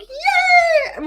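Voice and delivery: high laughing shouted yay; gleeful cheer. Retold or imitated. Sliding off the boat into the water, a high laughing whoop. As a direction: squeal with joy mid-laugh.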